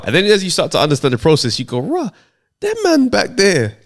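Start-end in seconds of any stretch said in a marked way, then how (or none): 1.8-3.8 amazed. Retold or imitated. Retold awe at older sitcoms.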